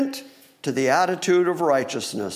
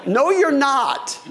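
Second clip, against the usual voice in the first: higher, louder, slow retort with a laugh; wild prophet; 8 semitones higher, 4 dB louder, slower than usual. Acted. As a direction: snap back like a rough wild man, half-laughing. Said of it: "this [name] looking guy shows up and goes".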